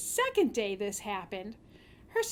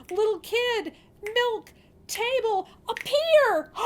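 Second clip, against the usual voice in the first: high, loud, building to a cry; hungry girl calling out the magic words; excited spell; 10 semitones higher, 6 dB louder, slower than usual. Acted. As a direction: call the charm with rising hope.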